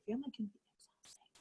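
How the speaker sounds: a confiding whisper to the room; whispered aside. Real, not acted. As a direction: lean in and whisper it like a secret.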